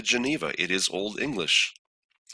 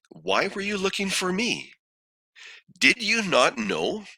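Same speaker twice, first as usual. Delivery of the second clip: lightly voiced son, higher pitched questions; questioning son; 9 semitones higher than usual. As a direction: put a higher, puzzled-sounding question to the parents. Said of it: "He asked them".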